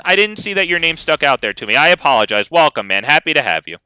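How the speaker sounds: ordinary talk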